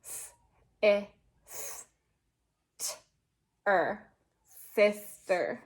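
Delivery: "s, i, s, t, er" said sound by sound, then "sister" whole; teacher checking the sounds of sister; spelling out sounds. Shown as a demonstration. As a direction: list each sound slowly, then say "sister". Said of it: "So now you should have".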